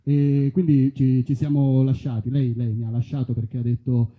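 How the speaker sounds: normal talking voice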